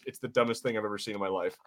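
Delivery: ordinary talk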